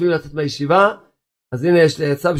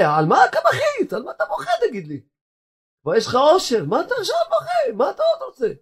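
much higher, half-laughing disbelief; incredulous rabbi; 13 semitones higher, 3 dB quieter than usual. Acted. Rabbi Abba quizzing the weeping bachelor, high and incredulous. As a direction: ask why he's crying, amused and baffled.

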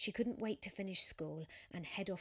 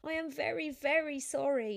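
higher, louder rushed apology; flustered pleading; 8 semitones higher, 9 dB louder than usual. Acted. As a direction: plead as you explain your awful day. Sophia pleads with angry cyclist.

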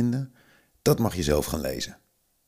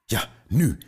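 a sharp high call, louder; 7 semitones higher, 5 dB louder than usual. A narrator's reading. Veo signals the children to attack, loud call. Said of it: "riep ze".

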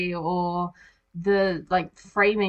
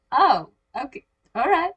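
surprised; 6 semitones higher, 4 dB louder than usual. Retold or imitated. Retold surprised reaction.

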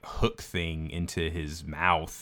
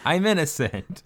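gloating villain; 9 semitones higher than usual. Retold or imitated. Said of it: "he has the best, like, villain laugh".

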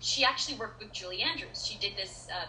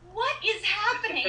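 high, louder disbelief; astonished; 11 semitones higher, 5 dB louder than usual. Retold or imitated. Mother recalling praise of her daughter, astonished. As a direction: retell being stunned, voice shooting up.

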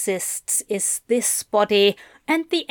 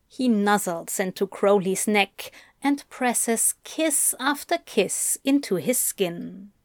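usual speaking voice

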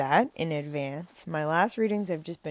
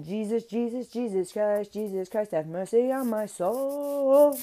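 fervent prayer; 4 semitones higher, 3 dB louder than usual. Retold or imitated. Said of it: "I'm saying".

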